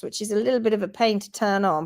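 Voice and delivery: usual speaking voice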